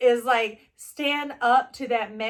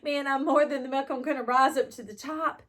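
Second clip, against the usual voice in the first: retold crying; 6 semitones higher than usual. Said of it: "And, and crying, going".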